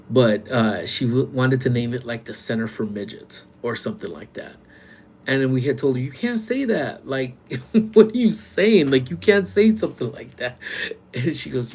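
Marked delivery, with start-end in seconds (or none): 6.0-10.6 high, loud mock disbelief with a laugh; incredulous retold objection. Staff tell a lady she can't say that, retold incredulous. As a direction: object as if you can't believe your ears.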